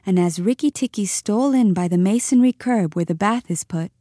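ordinary delivery, nothing marked

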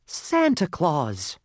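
high, slow, drawn-out cry of recognition; amazed talking lump of coal; excited cry. A narrator's reading. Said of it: "cried the lump of coal".

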